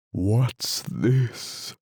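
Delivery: sleepy lion's hollow toll, like a great bell; deep, hollow tone. A narrator's reading. Drowsy lion blinking at Alice, deep hollow tone. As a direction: lazily intone it as a weary lion. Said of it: "speaking in a deep, hollow tone".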